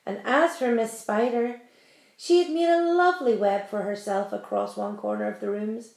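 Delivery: ordinary delivery, nothing marked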